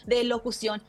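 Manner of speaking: ordinary talk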